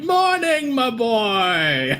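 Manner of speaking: high, hammy fatherly greeting; pompous old tree hailing the boy. Acted. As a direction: play a jolly old guardian tree. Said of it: "And he's like".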